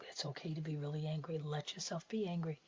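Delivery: soft, quick reassurance; soothing inner voice. Acted. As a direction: gently give permission to feel. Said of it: "you can hear the voice in your mind saying".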